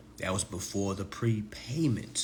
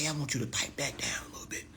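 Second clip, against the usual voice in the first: soft, quick, hushed aside; hushing someone; 3 semitones higher, 7 dB quieter, faster than usual. Retold or imitated. Group member telling another to pipe down, hushed. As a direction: lean in and quietly shush them.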